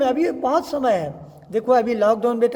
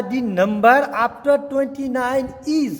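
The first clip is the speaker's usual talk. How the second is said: slow reading aloud; much slower than usual. Shown as a demonstration. Teacher reads the worksheet line slowly to students. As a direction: read each English word out slowly. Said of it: "कहता है".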